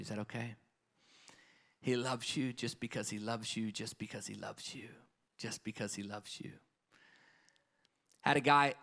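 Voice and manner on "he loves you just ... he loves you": soft, lower repeated reassurance; tender refrain. Real, not acted. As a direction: let it sink in gently.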